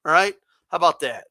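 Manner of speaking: ordinary talk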